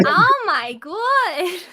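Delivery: loud, high, very slow catchphrase; Janice from Friends. Shown as a demonstration. Guest does the sitcom catchphrase, high and drawn out. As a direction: drag out every word in broad New York. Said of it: "Janice out of Friends".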